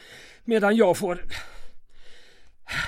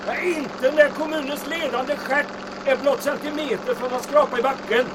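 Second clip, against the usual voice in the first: higher, louder yell over the engine; shouting over wind; 9 semitones higher, 3 dB louder than usual. Acted. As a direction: call out above the rushing wind.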